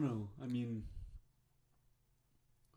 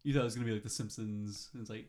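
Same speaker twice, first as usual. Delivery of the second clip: ordinary delivery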